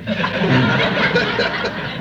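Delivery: a short laugh at the memory; amused laugh. Real, not acted. Preacher laughing about strong coffee.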